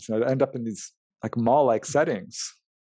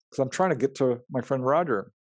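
ordinary talk

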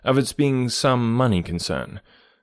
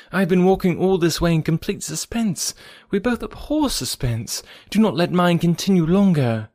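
eager, higher, urgent pleading; 7 semitones higher than usual. Acted. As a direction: press her friend eagerly for news. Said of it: "she eagerly said".

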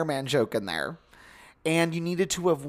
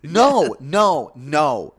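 disbelieving protest; 3 semitones higher, 7 dB louder, much slower than usual. Real, not acted. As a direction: protest each one as if it can't be true. Refusing to accept more required viewing.

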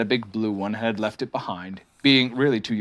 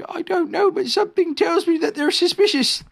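high-pitched, uneasy bear; Pooh bear, suspicious; 3 dB louder than usual. Acted.

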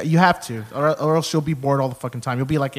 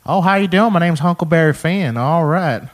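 Huckleberry Finn cartoon voice; 4 semitones higher, 4 dB louder than usual. Shown as a demonstration. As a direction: do a folksy cartoon character. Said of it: "a lot of cartoon voices too".

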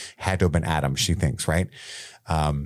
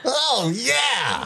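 Leonard squeal; 13 semitones higher than usual. Pretend. Voicing Leonard shouting.

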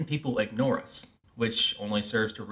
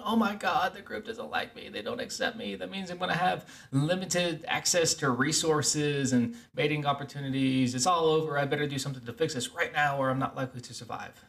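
higher-pitched mock panic; hammed-up inner panic, voice pitched up; freaking out; 4 semitones higher than usual. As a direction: act out an anxious brain spiralling into panic. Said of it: "Your primitive brain is freaking out".

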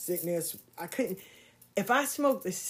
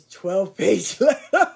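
louder, slower, laughing through it; 10 dB louder, much slower than usual. Real, not acted. Woman laughing about the long letter she wrote him, amused. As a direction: chuckle at how much you wrote. Laughing at her young self.

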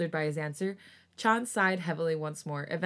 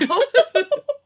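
loud high laugh; 12 dB louder than usual. Real, not acted. Laughs at author's note.

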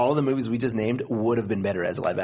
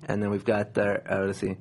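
ordinary delivery

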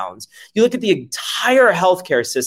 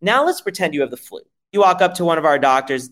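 usual speaking voice

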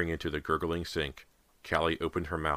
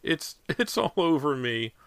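high, slow, stammered wail; miserable pleading; 11 semitones higher, slower than usual. A narrator's reading. Girl covered in slimy goo, pleading miserably. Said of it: "Callie pleaded miserably".

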